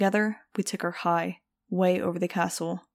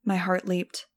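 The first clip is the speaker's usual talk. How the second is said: ordinary delivery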